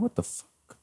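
high, hushed "what the..."; startled hushed aside. Retold or imitated. Man in bed hears his door jiggle, startled and hushed.

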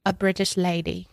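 put-on British lady voice; amateur British accent; terrible British accent. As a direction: play a British lady, badly. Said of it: "I think I have a terrible accent".

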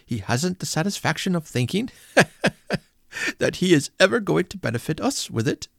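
put-on high, lively young man, laughing; amused nephew. Nephew dismissing uncle's wealth to his wife, high amused voice. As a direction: make light of the money.

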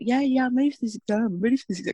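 mock the fourteen-year-old brushing off his mum; lightly hammed-up teenage son voice. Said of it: "I don't know why he doesn't talk with that voice".

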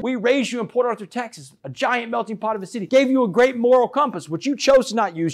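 high-pitched lecturing as mom; imitating a mother's indignant lecture; retold motherly reproach. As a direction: drive the reproach home, pitch well up. Retold mother scolding her sentenced son.

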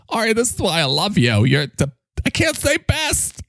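high, drawn-out gushing character voice; hammed-up, high-pitched worship; adoring microphone character. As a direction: swoon with admiration as an excitable little sidekick. Mike gushes over Ori's common sense.

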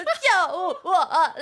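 calling a friend in tears, a hammed-up wail for rescue; mock panicked plea. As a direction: stretch out a desperate cry for help. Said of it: "我就打给你说".

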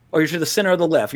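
usual speaking voice

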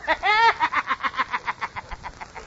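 tinny, whinnying cackle; horse-like laugh. Acted. Kid mocking the manager, a whinnying tin-can cackle. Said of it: "like a horse laughing into a tin can".

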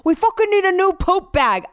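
mock-angry impression, high and loud; Brett. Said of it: "I don't even know how he's".

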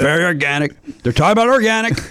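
Trump impression. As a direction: parody of the president's speaking voice. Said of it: "turn into James Austin Johnson doing Trump".

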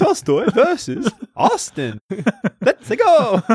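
high, slow hammed-up announcer hype; game announcer. Announcing a Tolstoy versus Austin match, high hammed-up hype.

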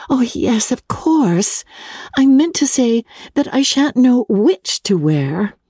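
a fretful bride fussing over what to wear; May, fretful young wife. Acted.

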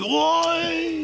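sudden high yelp; shouted Oi. Retold or imitated. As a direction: yelp as you lose footing. Retells cry while falling.

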